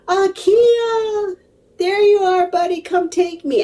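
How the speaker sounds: talking crystal. Pretend. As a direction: play the crystal as a tiny being, high and eager. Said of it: "this beautiful crystal just said".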